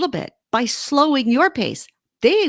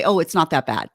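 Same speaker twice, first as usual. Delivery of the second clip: dismissive reassurance; 8 semitones lower than usual. Acted. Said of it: "Don't say".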